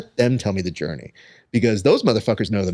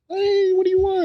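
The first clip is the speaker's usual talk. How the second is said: much higher lady voice; a light falsetto vendor greeting; imitating taco lady. The taco lady greets her regular in a falsetto. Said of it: "she's just like".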